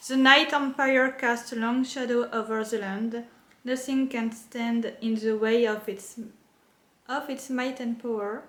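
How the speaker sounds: reading English with a French accent; halting, French-accented English. Real, not acted. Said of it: "mon accent anglais, bof".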